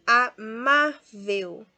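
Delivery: slow "Amável", loud on MÁ; penultimate stress. Shown as a demonstration. Teacher sorts words for students, slow "Amável" stressed on MÁ. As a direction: split "Amável" into syllables, punching "má". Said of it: "também paroxítona".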